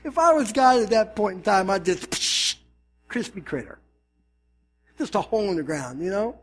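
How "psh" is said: hissed "psh" blast sound; imitated zap. Pastor pretends to be God striking a sinner, zap noise. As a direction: make a quick zapping noise.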